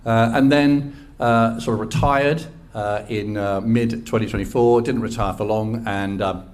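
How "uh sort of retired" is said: ordinary delivery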